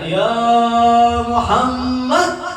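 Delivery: long drawn-out call, rising; retold cry. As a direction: cry out the name slowly, stretching it. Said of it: "Maka dia teriak".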